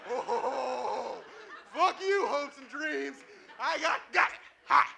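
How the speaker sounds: high, loud panicked yelps; frantic old man. Retold or imitated. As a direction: chase leaves in a frenzy.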